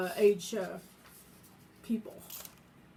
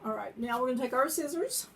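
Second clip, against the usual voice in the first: talking while chewing gum. Real, not acted. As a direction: speak around gum in the mouth. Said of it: "Okay, I have gum in my mouth".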